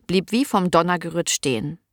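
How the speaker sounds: usual speaking voice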